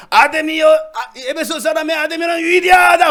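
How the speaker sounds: loud high slow rallying cry; high, loud protester's chant; protest shout. Shown as a demonstration. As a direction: cry out to rouse the crowd. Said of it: "시위를 하는데 한 사람이".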